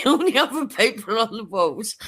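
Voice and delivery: high-pitched, helpless amusement; laughing while telling. Real, not acted. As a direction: tell it through real laughter, voice riding high. Laughs while telling the toilet scene.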